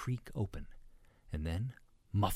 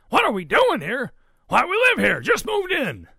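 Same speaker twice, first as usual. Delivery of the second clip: high, booming indignant reply; blustering Papa pig; 14 dB louder than usual. A narrator's reading. Papa pig says they just moved in, booming. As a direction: boom it back in disbelief.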